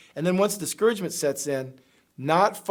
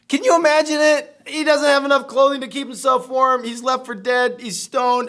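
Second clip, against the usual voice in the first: higher, louder, marveling; amazed exclamation; 6 semitones higher, 5 dB louder than usual. Real, not acted. Preacher marveling to friends at Paul's suffering, raised voice. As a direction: marvel at it out loud.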